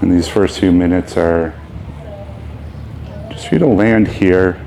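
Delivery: unhurried phrases with long, spacious pauses; guided meditation. Real, not acted. Teacher leading the opening meditation of a beginners' yoga class, unhurried. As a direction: guide them into ease, leaving room to breathe.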